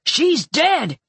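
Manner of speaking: high, sharp barked shout. A narrator's reading. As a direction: bark it to make her stop. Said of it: "Luther barked next to me, his voice sharp".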